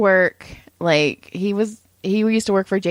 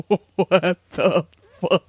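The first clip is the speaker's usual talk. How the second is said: baffled; 3 semitones lower, much slower than usual. Retold or imitated. Retelling own baffled reaction.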